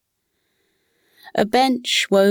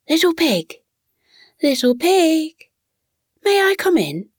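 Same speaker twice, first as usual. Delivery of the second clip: much higher, louder sing-song call; 10 semitones higher than usual. A narrator's reading. Wolf at the door calls again, louder, to the pig. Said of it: "and said a little louder".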